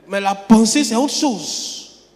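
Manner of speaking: normal talking voice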